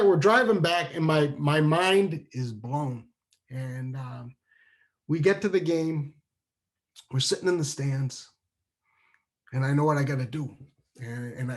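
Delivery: ordinary talk, nothing marked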